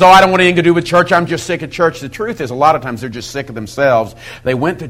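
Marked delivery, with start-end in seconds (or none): 0.0-2.0 quick, raised mock complaint; retold complaint. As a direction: imitate someone fed up, rushing it out higher. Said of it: "You find somebody who says".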